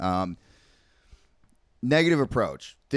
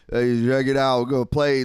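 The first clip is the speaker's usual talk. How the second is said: put-on loud, slurred drunken stage intro; slurring drunk frontman; 2 semitones higher, 5 dB louder than usual. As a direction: run the words together like a hammered singer. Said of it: "slurring his words".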